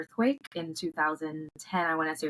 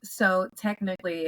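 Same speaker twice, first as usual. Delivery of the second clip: normal talking voice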